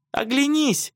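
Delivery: very high squealed warning; girl's high-pitched scream; shrieking. A narrator's reading. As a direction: shriek for them to turn round. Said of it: "завизжала Лиза".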